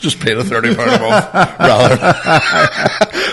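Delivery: real laughter at the punchline; laughing. Real, not acted. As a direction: get it out through laughter. Laughing while telling punchline.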